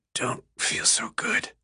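weak whisper. A narrator's reading. Sick boy fading back.

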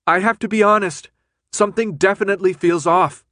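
loud, high-pitched reply. A narrator's reading. Brenda answering Thomas over the alarm, loudly.

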